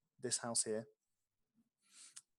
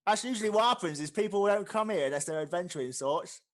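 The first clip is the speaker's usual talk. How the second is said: loud, raised character voice; orc innkeeper; 7 semitones higher, 8 dB louder than usual. Acted. Orc barkeep explains his usual customers, loud and high. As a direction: say it loudly over the tavern bustle.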